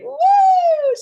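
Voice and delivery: high, loud, drawn-out "woo"; little girl's whoop. Acted. Coach voicing the free little girl inside, whooping. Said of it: "It's like a little girl".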